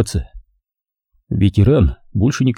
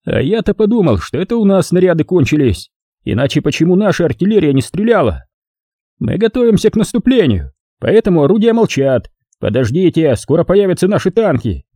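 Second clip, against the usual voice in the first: higher, self-assured holding forth; pompous sergeant; 7 semitones higher than usual. A narrator's reading. Sergeant holding forth to soldiers, pompous. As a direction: lecture the troops like a know-it-all sergeant. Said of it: "продолжал разглагольствовать фельдфебель".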